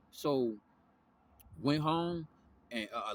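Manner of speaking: normal talking voice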